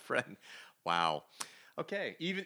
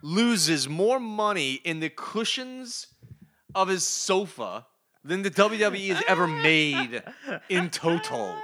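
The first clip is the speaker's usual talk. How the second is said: heated rant; 6 semitones higher, 5 dB louder, slower than usual. Real, not acted. Ranting about Disney's money.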